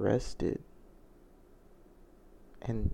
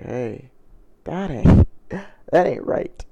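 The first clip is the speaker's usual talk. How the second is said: loud, higher-pitched retold protest; indignant objection; 4 semitones higher, 12 dB louder, much slower than usual. Husband confronting his wife about his mother, indignant objection. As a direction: push it out loud and high, dragging the repeat.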